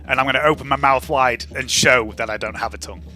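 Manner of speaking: ordinary delivery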